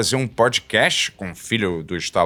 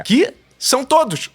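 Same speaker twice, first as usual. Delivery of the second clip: excited; 4 dB louder than usual. Real, not acted. Punchline shouted about the truckers.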